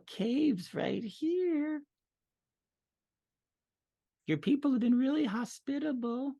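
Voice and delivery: mock-polite little goblin lady; female goblin voice.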